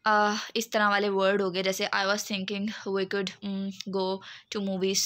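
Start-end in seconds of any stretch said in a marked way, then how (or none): 1.9-5.0 slow, halting, with an "um" pause; hesitant with fillers. Shown as a demonstration. Teacher imitating a boy hesitating with fillers, slowly. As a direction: drag it out unsure, hesitating. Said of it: "fillers का जो है वो बहुत ज़्यादा use करते हैं लड़के".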